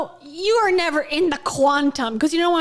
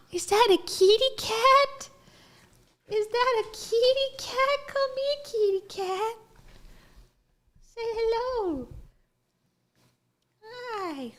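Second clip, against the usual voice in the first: cooing pet talk; 9 semitones higher, 4 dB quieter than usual. Real, not acted. Reactor calling a kitty cat over, high cooing voice. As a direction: coo sweetly, pitched way up.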